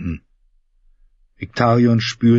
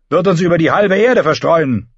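a raised, urgent warning voice; lord Harlow; 7 semitones higher, 4 dB louder than usual. A narrator's reading. Lord warning the ironborn council, urgent and raised. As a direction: press the danger on the council.

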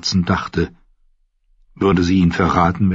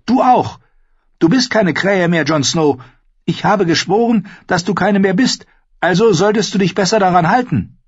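put-on high, bold young wildling woman; spirited young woman, teasing; Ygritte voice; 12 semitones higher, 3 dB louder than usual. Narrator voicing Ygritte.

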